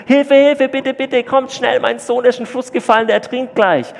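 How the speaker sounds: high, loud screaming for help; frantic mother yelling across the river for help; mother's desperate scream. Acted. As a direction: scream like a mother whose son is drowning. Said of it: "wie eine Mama schreit".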